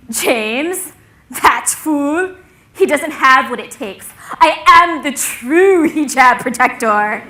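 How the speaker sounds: loud scoffing swelling into proclamation; mock-heroic boast, last line drawn out grandly; indignant bravado. Logan dismissing his rival James, indignant bravado.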